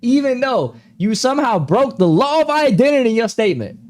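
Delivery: loud, high sarcastic protest; mock indignant comeback. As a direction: burst out in acted exasperation after giving in. Sarcastic comeback in acted argument.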